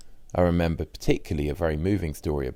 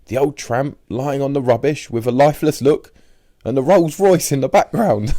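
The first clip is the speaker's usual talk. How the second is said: high, louder voice of an awestruck friend; imitating Richard; 10 semitones higher, 8 dB louder than usual. Richard recalling the tramp and the Rolls Royce, high and loud. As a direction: paint the picture wide-eyed and loud.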